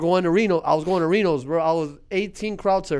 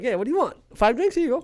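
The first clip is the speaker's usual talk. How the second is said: eager bartender; 10 semitones higher, 4 dB louder, faster than usual. Retold or imitated.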